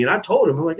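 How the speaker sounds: ordinary talk, nothing marked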